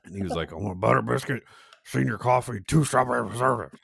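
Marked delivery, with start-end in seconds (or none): 0.5-2.6 put-on higher-pitched old-man voice; imitating old regular. Old man ordering from a teenage worker, imitated voice. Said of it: "he would say it like that". 2.7-3.8 put-on higher, quick, run-together old-man order; imitating old regular. Old customer ordering jelly from confused teen, mumbled impression. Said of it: "he would say it like that".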